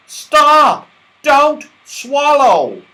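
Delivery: high, loud panicked yell. A narrator's reading. Moonface to Franny eating the cake, yelling in alarm. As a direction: bellow to stop her in time. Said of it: "yelled Moonface suddenly".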